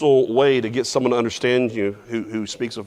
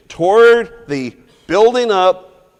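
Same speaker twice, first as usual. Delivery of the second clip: "Toward" slow and loud, dragged out; stress on toward; 6 semitones higher, 6 dB louder, slower than usual. Shown as a demonstration. Teacher stressing the aim of the verse. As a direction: stretch and press "Toward the building up". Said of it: "for emphasis".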